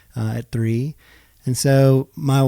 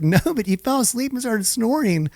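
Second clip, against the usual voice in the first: higher, mock-pleading wife voice; exasperated wife; 11 semitones higher than usual. Husband imitating his wife pleading for sleep. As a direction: whine it like the tired wife.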